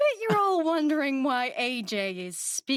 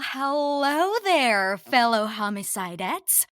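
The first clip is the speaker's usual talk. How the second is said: slow, drawn-out, louder host welcome; podcast host greeting; 7 dB louder, slower than usual. Acted. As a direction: greet the listeners with showy relish.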